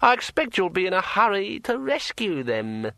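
like a finger round a wine glass rim; ringing metallic sword voice. A narrator's reading. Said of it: "it added".

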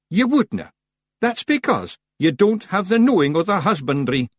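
high, crowing Scottish retort; triumphant Rob Anybody. A narrator's reading. Rob boasting of his knowledge, triumphant. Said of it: "said Rob Anybody triumphantly".

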